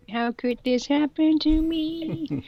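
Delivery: high, slow, theatrical wail; melodramatic despair. Acted. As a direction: ham up the tragic heroine. Melodramatic heroine lament.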